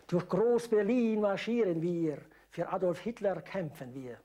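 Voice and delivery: loud, slow announcer. Retold or imitated. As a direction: call out the song title. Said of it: "sagte er an".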